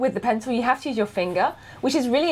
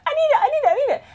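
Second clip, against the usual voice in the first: much higher, pleading over and over; excited pleading; much faster than usual. Retold or imitated. Retelling to her partner how badly she wanted the iPad, high excited pleading. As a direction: whine it like a kid who must have it. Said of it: "I was like".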